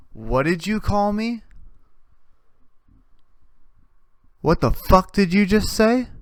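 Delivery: mock-outraged question; mock offense. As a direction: act offended at the insult. Offended man confronts.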